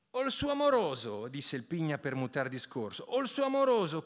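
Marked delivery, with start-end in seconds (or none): 0.1-1.3 high coaxing. A narrator's reading. Pigna changing subject. 3.0-4.0 high coaxing. A narrator's reading. Pigna repeats.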